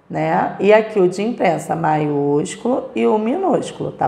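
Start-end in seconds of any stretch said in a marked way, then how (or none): none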